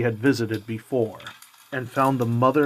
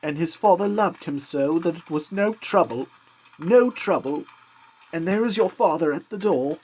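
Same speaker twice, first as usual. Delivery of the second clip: higher, gentle mother's voice; mother hiding her grief behind a higher voice; 6 semitones higher, 3 dB louder than usual. A narrator's reading. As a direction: voice the tired mother, lifted high. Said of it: "she resumed".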